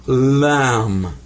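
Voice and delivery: "lam" very slow, vowel drawn out; Arabic letter name. Shown as a demonstration. Teacher names the Arabic L, long drawn-out "lam". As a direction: stretch "lam" into one long falling syllable. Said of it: "In Arabic, that's a".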